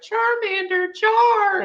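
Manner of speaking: very high squeaky creature call; Charmander voice. Pretend. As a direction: chirp the name like the Pokemon. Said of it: "the other ones are kinda regular".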